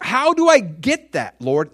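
high, loud, urgent question; eager plea. Retold or imitated. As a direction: blurt it out, desperate to know. Eager listener asks the Lord.

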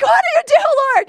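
high, loud cry to heaven; desperate cry. Retold or imitated. Exhausted mother crying out to the Lord. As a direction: plead to God at the top of your voice.

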